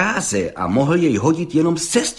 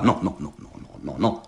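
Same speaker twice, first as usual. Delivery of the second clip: low, fading string of objections; muttered protest; 9 semitones lower, 7 dB quieter than usual. A narrator's reading. Judge objecting to the promised impunity, in a muted protest. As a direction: protest under the breath, trailing into a whisper. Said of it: "protestoval soudce".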